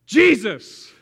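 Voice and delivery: loud one-word outburst; sudden yell. Retold or imitated. Preacher imitating a husband in his office, sudden yell. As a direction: yell it out abruptly after a pause. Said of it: "he yelled it out again".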